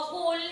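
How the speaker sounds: "whole" said with an oo, like "hool"; wrong vowel. Shown as a demonstration. Teacher showing pupils a misreading, "whole" with an oo. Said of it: "hindi rin whole".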